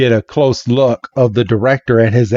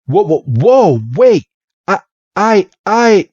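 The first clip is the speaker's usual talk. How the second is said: flustered protest; 9 semitones higher, 3 dB louder than usual. Retold or imitated. Red protests being introduced.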